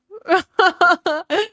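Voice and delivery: loud squealing laugh, real and helpless; high burst of laughter. Real, not acted. Streamer cracking up at a cheeky chat message, loud laugh.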